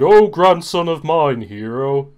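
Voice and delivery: loud, drawn-out in-character rebuke; hammed-up indignant dismissal; mock disowning. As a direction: disown them loudly and theatrically. Acted disowning of Hero in game.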